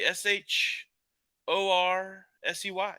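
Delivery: each letter S H O R S E Y slow and separate; spelled out slowly. Shown as a demonstration. Host spelling a viewer's name for chat, slow letters. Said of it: "spelling it out".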